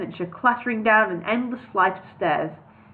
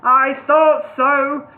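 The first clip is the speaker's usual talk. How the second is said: knowing; 4 semitones higher, 7 dB louder, much slower than usual. Acted. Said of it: "smiling knowingly".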